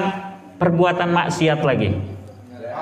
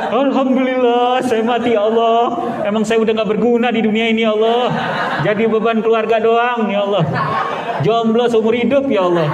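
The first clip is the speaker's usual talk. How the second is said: happy to die; 7 semitones higher than usual. Acted. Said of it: "Akhirnya seneng dia". (Cecep bersyukur mau mati, suara tinggi dan keras pura-pura gembira)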